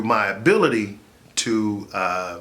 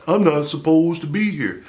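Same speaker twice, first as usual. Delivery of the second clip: quoting LeBron; 4 semitones higher than usual. Retold or imitated. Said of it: "LeBron James used that".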